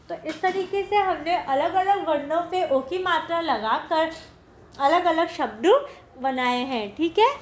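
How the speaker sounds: usual speaking voice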